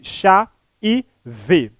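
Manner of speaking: "chá e vi" slowly, each piece separated by pauses; syllable by syllable. Shown as a demonstration. Teacher recapping the two syllables, "chá e vi" slowly spaced out. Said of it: "porque apresenta duas sílabas".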